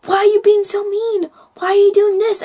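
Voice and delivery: high-pitched mock child complaint; whiny little kid. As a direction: imitate a little kid protesting. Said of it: "when little kids".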